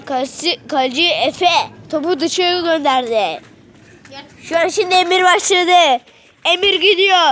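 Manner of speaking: quick live sports call; play-by-play commentary. Acted. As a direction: call the match as it happens. Live commentary of kids' match.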